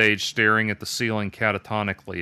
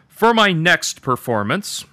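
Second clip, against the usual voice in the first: slow, loud, high proclamation; raised, drawn-out showman's delivery; bold stage announcement; 8 semitones higher, 8 dB louder than usual. A narrator's reading. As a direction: announce the act grandly to a crowd. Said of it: "began in a voice that w-, surprised even himself".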